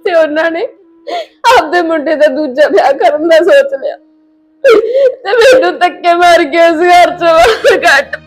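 tearful account collapsing into wailing; crying. Acted. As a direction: tell it through tears until you break down. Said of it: "ਤੂੰ ਰੋ ਨਾ ਪੁੱਤ".

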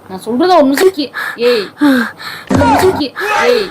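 begging her to respond, scared; real fear, voice climbing into tears; frightened pleading. Real, not acted. Real panic during fainting prank.

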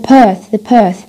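"perth" as path with a long back A; long back vowel, no R, in "perth"; British path. Shown as a demonstration. Teacher showing British speech, back A in "perth". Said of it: "بحكي بريطاني".

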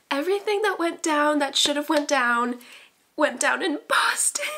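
loud, high, worked-up rant; excited outburst. Real, not acted. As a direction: belt it out in fangirl disbelief. Excited outburst about the setting.

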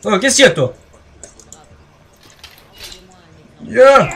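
loud taunting battle cry; battle shout. Real, not acted. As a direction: yell it at the enemy. Fighting an enemy in game.

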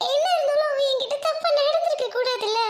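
high, sobbing protest; sob, then protest through the tears; crying. Acted. Girl insisting her boyfriend was wrong, tearful. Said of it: "அழுகாதடி".

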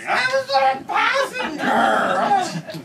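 very high, slow, drawn-out yell; hammed-up drunk protest at full voice; insistent yelling. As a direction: holler the same excuse stubbornly. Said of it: "he sticks to his story".

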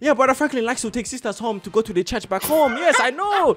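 put-on churchgoer gossip, rising high at the end; knowing churchgoer. Churchgoer telling on Brother Franklin and the sisters, knowingly. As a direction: tell on him in character.

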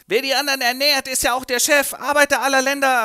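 high, loud ranting revolutionary; rabble-rouse the workers. Acted. Revolutionary speech.

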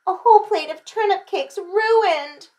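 high, loud lament; storybook child's dismay; groaning child. A narrator's reading. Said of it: "groaned little Chan".

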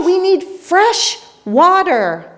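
high, slow desperate yell on need; yelled back. A narrator's reading. Spanish sailors yelling back to Peruvians, high desperate yell. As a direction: shout it back, afraid of being misunderstood. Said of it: "yelled back".

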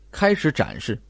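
ordinary delivery

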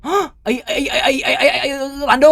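high, loud, crying stammer; jump-scared gamer stammering in terror; panicked scream. Real, not acted. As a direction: scream in fright, stuck on one word. Jump scare in horror game.

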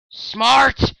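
high, loud goofy cry; silly shout. Pretend. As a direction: yell it like a proud nerd. Mock smart voice.

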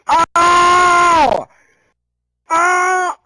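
loud high cry of frustration; shouting in frustration; strained yell. Real, not acted. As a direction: let out a pained high yell. Strained shout mid-rap.

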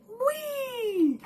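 high squealed whee; sudden high delighted squeal; gleeful whee. Real, not acted. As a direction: squeal it out with glee. Gleeful squeal about costs.

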